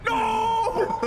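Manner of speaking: very high, long wail; imitated dog howl. Owner showing how the dog sounded, howling wail. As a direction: howl it out in mock distress. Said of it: "literally sounded like her going".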